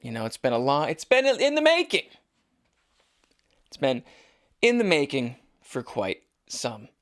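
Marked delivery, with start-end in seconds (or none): 0.9-2.1 much higher, mock-grand delivery; hammed-up high voice. Host hyping the long-awaited show, hammed-up high voice. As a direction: pitch it way up for comic hype. Hammed-up restatement of his hype.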